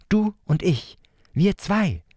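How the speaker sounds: hohe, eifrige Kinderstimme; zählt und drängelt wie ein kleiner Junge; kleiner Bruder. Acted. Leo als kleiner Bruder. (hohe, eifrige Kinderstimme)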